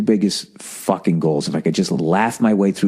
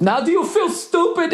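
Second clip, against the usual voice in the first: much higher, sneering put-on voice; mocking smug driver. Driver taunting me on the street, high sneering mock voice. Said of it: "He just goes".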